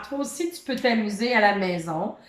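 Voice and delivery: ordinary talk, nothing marked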